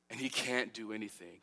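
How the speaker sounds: ordinary delivery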